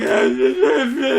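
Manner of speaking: high, loud imitated blubbering; mock sobbing reply. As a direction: blubber wordlessly like the crying girl. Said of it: "And she's like".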